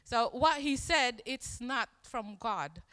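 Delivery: ordinary talk, nothing marked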